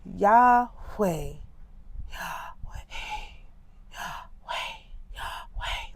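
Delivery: Yahweh said as a breath drawn in, fading to a whisper; mock the evangelist breathing Yahweh in; breathy breathed-in Yahweh. Mocking an evangelist's breath-name demo, breathed in. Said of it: "the way that you say Yahweh is when you breathe in".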